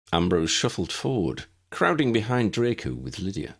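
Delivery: ordinary delivery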